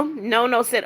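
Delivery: ordinary delivery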